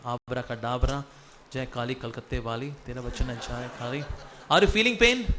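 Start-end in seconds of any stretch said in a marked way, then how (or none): 0.0-4.0 low, hushed mock magic incantation; kids party magician. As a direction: murmur it like a stage magician's spell. Mock magic spell over paper.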